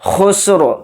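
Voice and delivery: slow, drawn-out tajweed chant; Quran recitation. Shown as a demonstration. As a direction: recite it slowly and melodically. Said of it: "खा को सीन में मिलाकर पढ़ेंगे".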